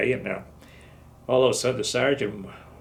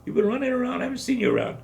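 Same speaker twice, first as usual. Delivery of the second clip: high, angry retold yell; mock sergeant shouting; 12 semitones higher, faster than usual. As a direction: bark at him like a sergeant. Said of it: "the first sergeant cries".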